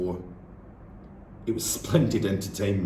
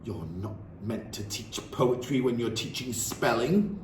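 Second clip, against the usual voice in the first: headmistress scolding; 6 semitones higher than usual. Acted. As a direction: tear a strip off the teacher. Trunchbull scolds the teacher.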